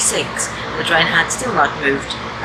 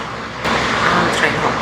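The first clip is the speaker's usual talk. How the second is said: slower and clearer behind a face mask; slow repeat through mask; slower than usual. Real, not acted. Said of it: "not sure if you can hear me with the mask on".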